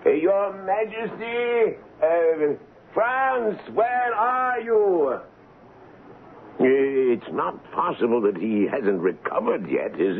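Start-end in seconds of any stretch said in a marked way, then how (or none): 0.0-5.2 high, drawn-out calls into the empty lodge; searching the rooms, calling a servant's name. Acted. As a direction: call for Franz, growing worried. Colonel calling for missing servant.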